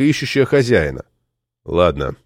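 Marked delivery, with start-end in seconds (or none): 1.6-2.2 low, dry, grudging agreement; dry assent. A narrator's reading. Doctor gives in to the request, low dry tone. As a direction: concede dryly, pitch dropped. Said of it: "сухо произнёс доктор".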